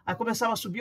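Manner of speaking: ordinary talk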